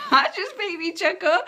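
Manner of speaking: amused, giggling as you speak; chuckling. Real, not acted. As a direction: laugh through the words. Laughing at kidney stone quote.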